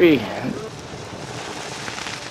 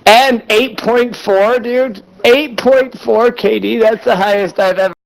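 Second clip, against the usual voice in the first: hyped frat bro, higher and slowed; 6 semitones higher than usual. Real, not acted. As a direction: stretch out the stats like a bro bragging. Hyped about a huge game.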